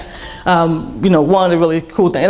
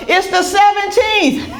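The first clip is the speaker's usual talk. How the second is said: high, drawn-out mock shout from a crowd; slow, high-pitched group holler, imitated; imitating a yell; 13 semitones higher, slower than usual. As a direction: yell it out like a whole exasperated queue. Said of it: "everybody else in the line yells out".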